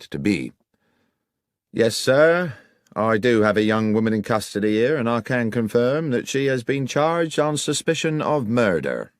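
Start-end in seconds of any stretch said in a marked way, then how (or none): 1.7-9.1 lightly voiced officious policeman; stiff, self-important reply; sergeant, cool. Sergeant Berry answers Holmes, lightly voiced and officious.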